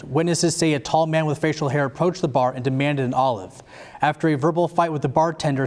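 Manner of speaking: ordinary talk, nothing marked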